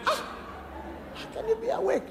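very high astonished question; doctor's disbelief. Retold or imitated. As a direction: squeak out the doctor's amazement. Doctor voiced again in disbelief.

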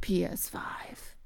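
slow breathy whispered reveal; trailer whisper. Retold or imitated. Mimicking the trailer's big reveal, a slow hushed whisper.

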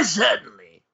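ordinary talk, nothing marked

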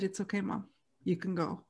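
soft, gentle permission to let go; quiet and loving, easing her along; tender reassurance. Retold or imitated. Children at the deathbed telling mom she can go, soft and tender. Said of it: "we all said".

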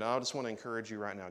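normal talking voice

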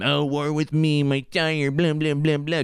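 whiny put-on self-pity, hurried; mocking woe-is-me voice; mock whining victim. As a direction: imitate a moaning victim, tailing into blahs. Mocks someone playing the victim.

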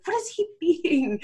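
high, drawn-out question with a laugh in it. Retold or imitated. She asks her hand about her husband, questioning. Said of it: "I found myself questioning everything".

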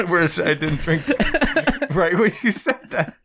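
high, giggling through the words; laughing. Real, not acted. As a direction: talk while cracking up.

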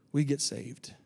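ordinary talk

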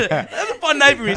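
laughing through the words, high and loud; can't hold back a laugh as you start the story; giggling into the line. Real, not acted. Laughing while telling story.

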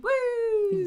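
high excited cheer; excited whoop. Real, not acted. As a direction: whoop with joy. Cheering Hocus Pocus.

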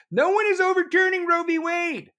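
high, loud exasperated rebuttal; exasperated outburst. Real, not acted. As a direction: shout down the claim in disbelief.

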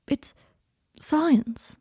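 hushed awe at a magic word; childlike wonder. A narrator's reading. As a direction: say science like a child saying magic. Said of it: "the way a child might say the word magic".

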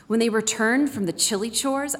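ordinary talk

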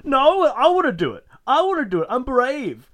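high, loud eager pleading; mocking an overeager hero; eager kid voice. Michael J. Fox eager plea.

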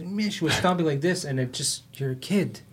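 ordinary delivery

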